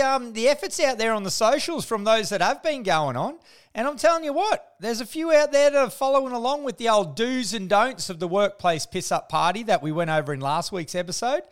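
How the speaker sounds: ordinary delivery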